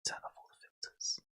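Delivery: hushed near-silent whisper; ASMR whisper. Real, not acted. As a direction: whisper so they can hear the keys. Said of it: "This is gonna be some A S M R shit".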